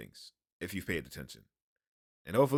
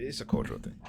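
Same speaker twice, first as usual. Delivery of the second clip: breathless whisper, much softer; whispered aside; 2 semitones higher, 7 dB quieter, faster than usual. Real, not acted. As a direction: drop to a tired whisper: "It's a cultural thing.". Weary aside repeated twice.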